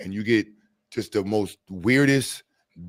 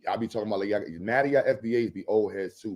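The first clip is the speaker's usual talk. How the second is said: usual speaking voice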